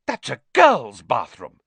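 loud scandalised exclamation; appalled outburst; shocked gasp. A narrator's reading. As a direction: blurt it out aghast. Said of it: "he gasped".

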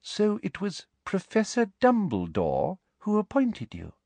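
sugary high-pitched official woman; sweet, prim and very high; prim high Umbridge voice. Acted. Umbridge questions Trelawney.

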